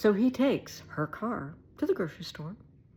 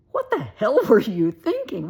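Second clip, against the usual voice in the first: loud, raised-pitch retold telling-off; incredulous scolding; 4 semitones higher, 10 dB louder than usual. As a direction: scold him in mock disbelief. Scolding him about his plan.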